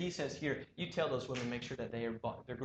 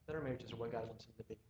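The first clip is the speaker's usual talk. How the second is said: normal talking voice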